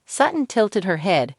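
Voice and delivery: usual speaking voice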